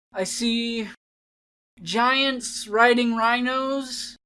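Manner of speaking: bored poem performance; bored child reading. Acted. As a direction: drag every line like nothing matters.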